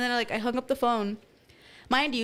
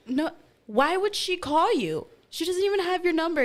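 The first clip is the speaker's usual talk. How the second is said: incredulous; 7 semitones higher, 3 dB louder than usual. Retold or imitated. Sister doubting her stoned sibling, incredulous. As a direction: scoff in a raised, slowed voice.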